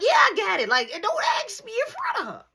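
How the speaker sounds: high, urgent panicked voice; panicked hush. Retold or imitated. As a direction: hurry him to drop it in front of nana. Nana literally right there.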